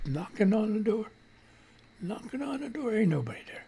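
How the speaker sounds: hushed creepy sing-song; spooky whisper. Pretend. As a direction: make it eerie and hushed.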